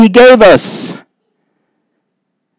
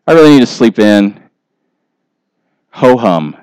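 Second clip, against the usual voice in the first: lower, lazy ho-hum sighs of boredom; bored churchgoer; 5 semitones lower than usual. Acted. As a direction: yawn through it like a bored believer who'd rather go fishing. Bored believer acted out.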